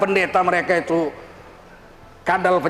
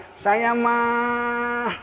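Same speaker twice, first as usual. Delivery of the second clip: very slow, softer, mild-mannered drawl; mock the soft-spoken preacher's easy calm; lightly voiced gentle preacher, unhurried; imitating a gentle preacher; 4 dB quieter, much slower than usual. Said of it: "Ada yang lembut".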